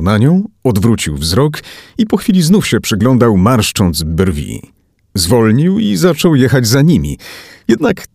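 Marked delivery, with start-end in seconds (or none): none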